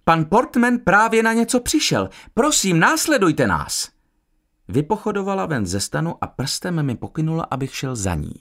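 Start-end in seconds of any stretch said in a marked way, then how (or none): 0.0-3.9 high-pitched woman's announcement; hailing the group. A narrator's reading. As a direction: call everyone to follow, pitched high. (halasné oznámení)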